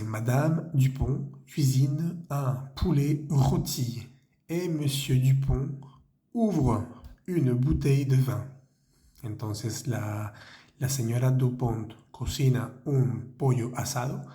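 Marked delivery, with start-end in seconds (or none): 0.0-8.4 careful slow read of the French sentence. Shown as a demonstration. Teacher reads the French sentence slowly to the class. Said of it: "La tercera frase".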